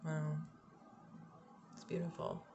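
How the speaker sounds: usual speaking voice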